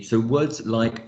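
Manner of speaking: ordinary talk, nothing marked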